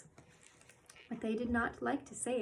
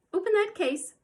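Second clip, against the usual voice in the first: sharp command; 10 semitones higher, 9 dB louder than usual. Acted. Mother commands daughter.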